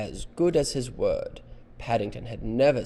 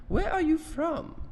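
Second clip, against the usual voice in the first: very high, louder question; high-voiced woman; 5 dB louder than usual. Missus Brown questions the bear, high-voiced. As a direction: ask it in a high lady's voice. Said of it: "this is the woman with the high voice".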